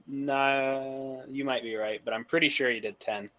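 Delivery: ordinary talk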